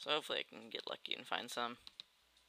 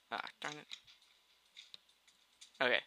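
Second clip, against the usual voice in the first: normal talking voice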